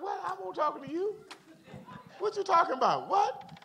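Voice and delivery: high-pitched, indignant comeback to the phone; hammed-up, high-pitched disbelief; mock incredulous retort. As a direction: act out baffled objection, voice shooting up high. Retells arguing with a listening phone.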